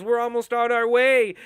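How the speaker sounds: Kathleen on the radio. Retold or imitated. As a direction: bark it like a ruthless leader. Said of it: "He goes".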